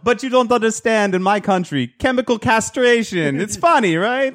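high, sing-song imitation of an Indian shopkeeper's accent; mock Indian accent. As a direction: ham up the Indian accent of the joking clerk. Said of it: "I can't do accents".

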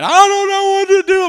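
very high, loud wail imitating a crying wife; mock sobbing. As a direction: wail it, hammed-up and squealing. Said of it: "She's sobbing, okay? She's just crying".

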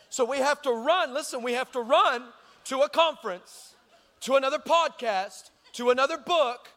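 emphatic preaching. Real, not acted. Preacher chiding churchgoers chasing sparks, emphatic preaching. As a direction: pile up each phrase like a preacher building a point.

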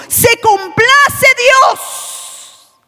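fervent sung-out sermon cadence; preaching at full force. Acted. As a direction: thunder the verse over the music. Preaching climax.

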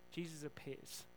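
ordinary talk, nothing marked

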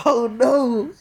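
a mock wail of worry about the result; mock panic.

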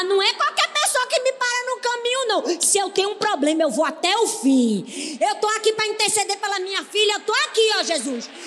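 high, loud, insistent declaration; shouting her refusal to give up; loud persistent plea. Acted. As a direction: proclaim it like you refuse to quit. Preacher voicing the persistent woman.

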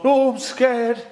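high, loud, hammed-up fright; mock scared. Preacher acting Saul facing Goliath, mock scared. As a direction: play a cartoonishly frightened king. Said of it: "Saul has gone".

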